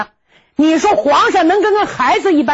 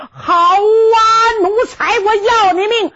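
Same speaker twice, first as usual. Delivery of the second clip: angry shout; 7 semitones higher than usual. Acted. Grandson shouts at grandfather.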